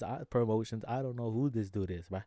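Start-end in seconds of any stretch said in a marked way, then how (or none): none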